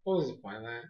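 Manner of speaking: low, soft and unimpressed; underplay it, almost to yourself; deadpan bafflement. Retold or imitated. Reviewer reacting to a pointless villain scheme, quietly baffled.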